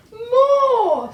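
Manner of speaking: booming teacher dragon; Madam Dragon. A narrator's reading. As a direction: roar the word out.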